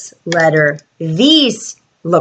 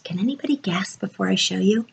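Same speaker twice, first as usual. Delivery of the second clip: fast, unvoiced secret whisper; 9 dB quieter, much faster than usual. Teacher teasing the guess.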